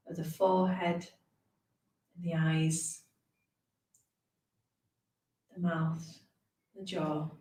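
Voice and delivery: calm, unhurried meditation guide with long pauses; guided meditation. Acted. Teacher leading beginners through a brief body scan, guided meditation voice. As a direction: ease listeners gently down through a body scan.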